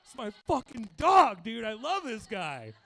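loud, high, drawn-out mock elation; hammed-up shout of bro love; acted gleeful bro cheer. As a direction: cheer on your buddy from the background. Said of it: "I was just in the background like".

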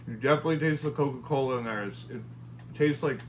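talking between bites; chewing while talking. Real, not acted. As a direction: mouth partly full of cookie. Seen 0: Bites cookie at 0.4, hand at mouth chewing till 6.3.

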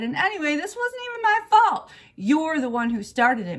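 put-on high, loud childish protest; aggrieved kid shifting the blame; indignant outburst. A narrator's reading. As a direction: argue back hotly, voice shooting up. Acted quarrel between two girls.

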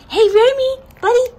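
high, loud coaxing call; calling the dog. Real, not acted. Owner calling dog Remy over, high and loud. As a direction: coax him over, bright and loud.

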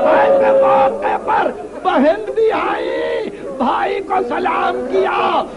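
impassioned majlis lament. A narrator's reading. Preacher recounting the evening of Karbala to mourners, impassioned lament. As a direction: cry out each phrase of the tragedy.

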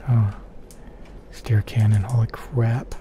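ordinary talk

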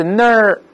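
ner with the r sounded; curled first syllable. Shown as a demonstration. Teacher splits the American word, ner with curled r. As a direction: say ner alone, tongue curled back. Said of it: "前面有字母R卷舌头".

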